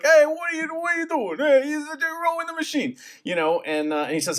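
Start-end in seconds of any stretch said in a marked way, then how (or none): 0.0-2.9 much higher hammed-up Italian uncle accent; hollering drunk Italian uncle, hammed-up. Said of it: "His Italian uncle's like".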